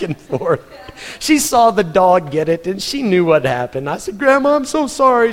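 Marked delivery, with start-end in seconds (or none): 4.2-5.3 higher, pleading sorry; anxious apology. Retold or imitated. Boy to grandma over the dead rooster, anxious apology. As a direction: beg forgiveness like a scared boy.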